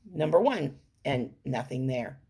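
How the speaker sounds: ordinary delivery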